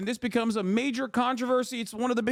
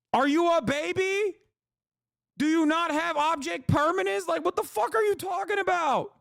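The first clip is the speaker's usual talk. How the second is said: higher, incredulous questions; mock them for forgetting; exasperated disbelief; 5 semitones higher than usual. Real, not acted. Exasperated rant at doubters.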